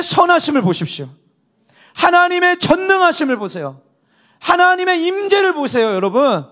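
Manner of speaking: high, loud impassioned exhortation; fervent preaching. Acted. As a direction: urge them on with full force. Preaching at full force.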